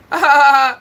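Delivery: a burst of loud, high laughter. Real, not acted. Streamer laughing out loud at the troll he just banned.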